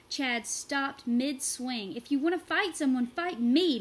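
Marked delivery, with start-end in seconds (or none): 2.0-3.8 loud, high dare; challenging. A narrator's reading. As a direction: throw down the challenge. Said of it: "Marshall challenged".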